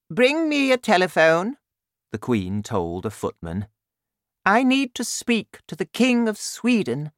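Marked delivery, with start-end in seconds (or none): 0.1-1.6 very high, loud regal order; commanding Queen. A narrator's reading. Queen ordering a footman, high and imperious. 4.4-7.1 high-pitched regal voice; commanding Queen. A narrator's reading. Queen demanding the King of Sweden, high and regal. Queen orders a royal call.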